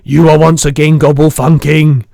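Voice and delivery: loud booming giant shout. Acted. Giant scolding the girl, a booming shout. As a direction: bellow it like a cross giant. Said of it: "the giant shouted".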